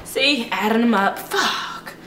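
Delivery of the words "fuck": breathy under-breath swear; whispered curse. Real, not acted. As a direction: mutter it to yourself. Muttered curse while counting dishes.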